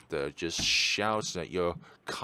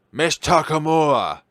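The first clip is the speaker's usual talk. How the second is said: loud call across the room; 5 semitones higher, 12 dB louder than usual. Acted. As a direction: shout her name to stop her. Calling out to neighbour.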